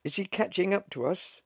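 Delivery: very high, loud, urgent question; anxious shout. A narrator's reading. Wife asking the officer about her husband, anxious shout. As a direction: call out in alarm.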